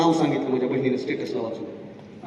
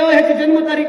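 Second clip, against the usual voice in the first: calling out; 8 semitones higher, 6 dB louder than usual. Retold or imitated. Teacher calling after the father.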